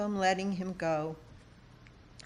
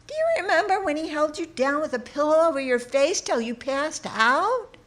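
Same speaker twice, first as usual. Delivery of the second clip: higher, faster, louder cousin's voice; cousin's question; 9 semitones higher, 6 dB louder, faster than usual. Retold or imitated. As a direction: voice the cousin, high and quick, a hint of disbelief. Cousin's question quoted in memoir.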